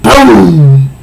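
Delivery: mock boom. Explosion sound effect.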